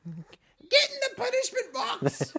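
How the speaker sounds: very high, drawn-out British wife impression; mock British wife. Imitating a wife ordering her husband in, high mock British. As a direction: screech it slowly in a put-on British falsetto. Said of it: "My wife's not British".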